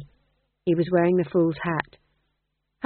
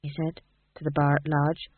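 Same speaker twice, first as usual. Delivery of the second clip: ordinary delivery, nothing marked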